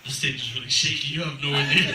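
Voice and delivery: ordinary talk, nothing marked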